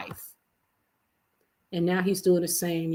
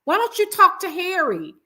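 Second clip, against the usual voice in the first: imagined mother's rebuke; 9 semitones higher than usual. Retold or imitated. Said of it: "Just say".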